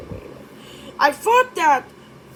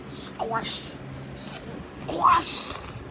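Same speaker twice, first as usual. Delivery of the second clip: whispered squelch; 6 semitones higher, 4 dB quieter than usual. Pretend. Muddy squelch sound effect.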